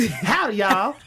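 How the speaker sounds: mock Texan greeting, breaking into laughter; playful Texas accent attempt; Houston accent. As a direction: try a hammed-up Houston twang. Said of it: "She's from Houston".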